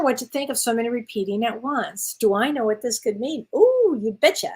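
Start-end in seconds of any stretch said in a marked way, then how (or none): 3.5-4.5 high, bright Wisconsin 'Ooh, you betcha' with a rising ooh; Wisconsin accent. Shown as a demonstration. Answering a viewer's question in a Wisconsin accent. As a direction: play up the Wisconsin folksy cheer. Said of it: "That's what we say in Wisconsin".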